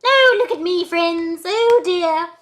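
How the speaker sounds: trying out a very high, loud voice; put-on voice. Vlogger retrying the voice just discovered, high put-on voice. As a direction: exclaim in a silly high voice. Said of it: "let me try to do it".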